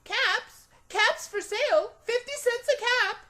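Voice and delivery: high ringing shout to the street; loud peddler call. A narrator's reading. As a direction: belt it out to sell caps. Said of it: "walked down the street calling".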